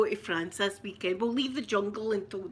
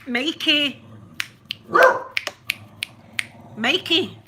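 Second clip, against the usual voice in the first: loud, higher-pitched call; 6 semitones higher, 9 dB louder, slower than usual. Real, not acted. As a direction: call someone from across the house. Calling out to Mikey.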